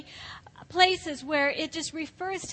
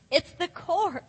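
ordinary talk

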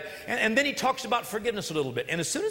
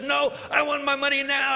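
higher, louder angry demand; 6 semitones higher, 4 dB louder than usual. Acted. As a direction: grab him by the neck and demand it. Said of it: "this guy gets angry".

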